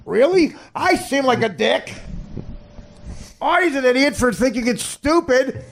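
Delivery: high-pitched, exasperated rant; outraged. Real, not acted. Comic mocked for hating the dancing, outraged. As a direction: protest in high, sarcastic disbelief.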